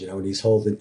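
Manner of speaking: ordinary delivery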